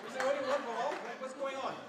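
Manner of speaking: loud, high mock bafflement; mock outrage. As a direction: shout it in cheeky disbelief. Mocking boys' football.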